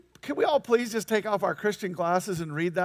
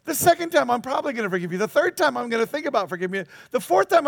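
mock exasperation; 3 semitones higher, 7 dB louder than usual. Counting forgiveness in mock exasperation.

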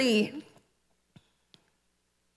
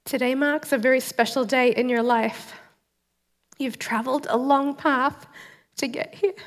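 solemn, measured welcome address read aloud, softer; formal ceremony speech; 3 dB quieter than usual. Acted. New citizen reads the welcome speech to viewers, solemn and moved. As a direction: read it like a judge at a naturalization ceremony, holding back tears.

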